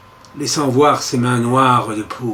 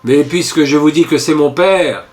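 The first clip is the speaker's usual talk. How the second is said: usual speaking voice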